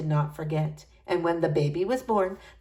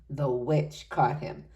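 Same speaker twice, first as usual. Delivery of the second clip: ordinary delivery, nothing marked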